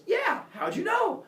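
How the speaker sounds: high, loud, drawn-out astonishment; amazed. Acted. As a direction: shout it in delighted disbelief. Nymph amazed Apollo guessed.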